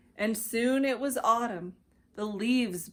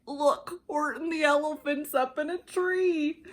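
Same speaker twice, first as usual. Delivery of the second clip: gleeful shout; 6 semitones higher than usual. Acted. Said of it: "shouted with glee".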